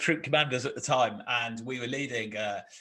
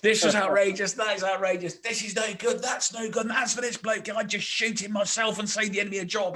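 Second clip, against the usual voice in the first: much higher and louder furious rant; berserk rant; 8 semitones higher, 4 dB louder than usual. Retold or imitated. Paul venting to Tim behind a tree, furious loud rant. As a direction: let rip, going berserk. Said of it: "Paul just went berserk".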